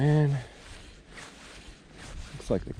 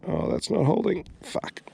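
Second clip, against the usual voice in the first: exasperated; 12 semitones higher than usual. Real, not acted. Knot fails again.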